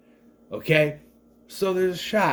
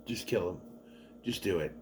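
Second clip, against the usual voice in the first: low, soft, fed-up aside; weary aside; 8 semitones lower, 12 dB quieter than usual. Retold or imitated. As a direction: say it under your breath, fed up. Weary aside at annoying characters.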